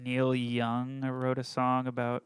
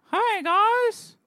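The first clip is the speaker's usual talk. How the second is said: very high, loud squeaky puppet greeting; 8 dB louder than usual. Pretend. Puppet introduced to the workplace, squeaky high voice. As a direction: pipe up as a hand puppet. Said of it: "This is Jimmy, and he's gonna be talking for me now".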